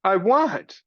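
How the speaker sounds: put-on high child's cry. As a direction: Meg crying out in the dungeon. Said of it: "cried Meg".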